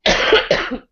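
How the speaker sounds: sick rattling cough; harsh cough. Real, not acted. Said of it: "feels like I'm coughing up my guts".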